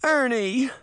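high-pitched call of his name. Acted. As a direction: call after him urgently. Bess calls out to Hamstead.

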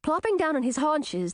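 ordinary delivery, nothing marked

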